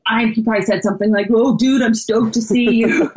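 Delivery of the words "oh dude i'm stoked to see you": amateur surfer-dude impression, slightly higher; mock surfer greeting; surfer talk. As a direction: play a stoked surfer, laughing at the end. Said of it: "I don't have really great surf talk".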